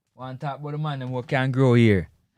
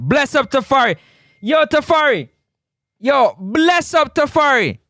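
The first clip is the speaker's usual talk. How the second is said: shout-out; 9 semitones higher than usual. Real, not acted. Shout-out to a viewer.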